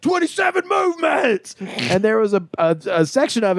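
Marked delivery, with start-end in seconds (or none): 0.0-1.6 very high shouted drill call; mock command shout. Karate instructor calling drills to his class, high mock shout. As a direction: bellow it like a crazed instructor.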